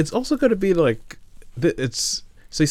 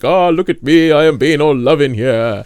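louder, hammed-up romantic hero; mock grand lover; 5 semitones higher, 6 dB louder, slower than usual. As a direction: ham up a showy lover's boast. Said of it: "being like".

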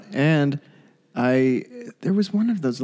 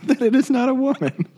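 pitched way up with laughter; laughing; 12 semitones higher than usual. Real, not acted. Cohost cracking up at the cat phrase, laughing high. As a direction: squeak it out mid-laugh.

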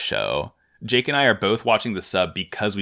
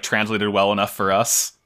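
ordinary delivery

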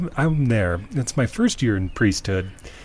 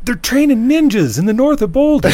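high, loud crazed street warning; frantic yelling; 14 semitones higher, 7 dB louder than usual. Retold or imitated. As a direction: imitate a man yelling wild news. Retold homeless man's urgent warning.

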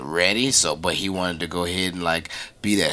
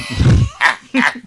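high laugh; 7 dB louder than usual. Real, not acted. Guest laughs at the joke.

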